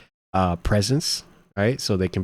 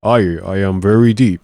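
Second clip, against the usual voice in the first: lightly put-on fake deep voice, a bit louder; mock deep voice; 4 dB louder than usual. Mocking the fake-deep scammer lady, mock deep voice. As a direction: poke fun at a woman faking a deep voice. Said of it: "She would just talk deep".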